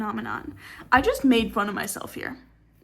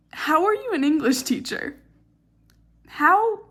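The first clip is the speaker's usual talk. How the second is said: higher, incredulous questioning; exasperated disbelief; 6 semitones higher, 4 dB louder than usual. Real, not acted. Student questioning a hated English teacher's competence, incredulous. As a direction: ask it in rising, exasperated disbelief.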